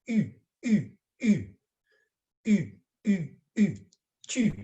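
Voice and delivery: short, high u with whistle-shaped lips; pursed-lip u. Shown as a demonstration. Teacher drilling pupils on the vowel, whistle-lipped u. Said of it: "as if you're going to whistle".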